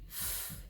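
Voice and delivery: whispered "F" hiss; f sound. Shown as a demonstration. Teacher showing the letter sound "F". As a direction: blow out a quiet "F". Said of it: "F says".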